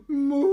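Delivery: high, loud cow moo. Pretend. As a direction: give it your full cow call. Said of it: "Give me Olivia sound".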